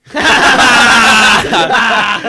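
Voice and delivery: shouted burst of laughter; loud laugh. Real, not acted. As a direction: roar with laughter. Laughing at the result.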